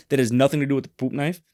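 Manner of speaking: ordinary delivery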